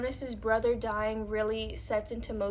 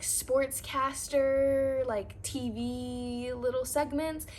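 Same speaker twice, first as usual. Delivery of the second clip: ordinary talk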